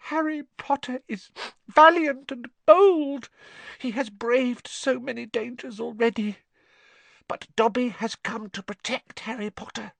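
high squeaky voice, solemn warning; earnest house-elf. Acted. Dobby come to protect Harry, squeaky earnest warning. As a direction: squeak with devotion, slowing for the warning.

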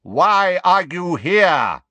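very slow, high, loud bellow; drawn-out angry roar at intruders. A narrator's reading. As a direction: bellow the question, stretching every word. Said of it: "bellowed the hard-faced grey centaur".

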